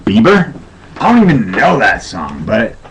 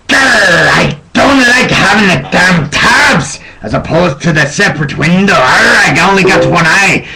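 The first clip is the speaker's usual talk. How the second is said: loud hammed-up pirate grumble; 3 semitones higher, 5 dB louder than usual. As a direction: snarl like a one-eyed pirate. Pirate complaining about browser tabs.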